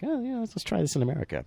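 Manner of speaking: lightly voiced breezy executive; mock studio executive. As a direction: toss it off like a casual studio boss.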